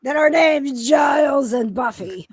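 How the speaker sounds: excited growl, high and loud; growling enthusiasm. Real, not acted. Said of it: "I'll quit growling".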